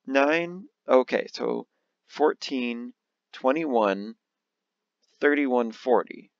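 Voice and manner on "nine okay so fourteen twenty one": ordinary talk, nothing marked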